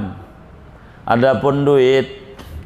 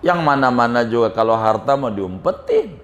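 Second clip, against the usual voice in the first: ordinary talk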